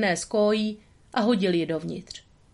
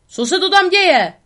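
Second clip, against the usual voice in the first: high, loud, furious yell; angry shout; 11 semitones higher, 9 dB louder than usual. A narrator's reading. Angry owner yelling at the dogs in his yard. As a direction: bellow at the intruders. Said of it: "ozval se rozlobený hlas".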